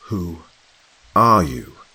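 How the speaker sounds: insist, pressing for an answer. A narrator's reading. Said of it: "I repeated, with more authority".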